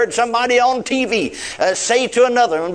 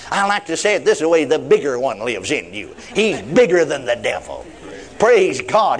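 ordinary delivery

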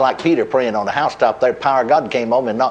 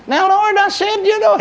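high-pitched pleading protest; arguing with the Lord. Retold or imitated. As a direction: push back on the Lord, voice jumping up high. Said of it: "here I go to arguing with him again".

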